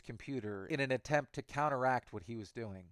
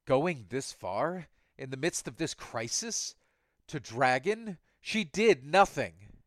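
angry; 3 semitones higher, 6 dB louder, slower than usual. Acted. Cyborg protests at Saint.

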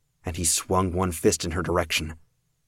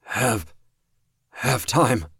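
strained; 6 semitones higher, 4 dB louder, much slower than usual. Acted. Dying man insists.

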